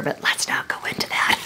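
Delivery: soft, breathy whispered joke; whispered aside. Real, not acted. Joking to viewers about being old, whispered aside. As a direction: let it slip out as a secret.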